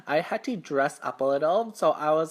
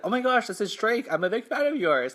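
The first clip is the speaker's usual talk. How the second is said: higher-pitched gushing at a celebrity; starstruck fan; 6 semitones higher than usual. Retold or imitated. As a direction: imitate a fan meeting an idol. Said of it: "That's how I said it".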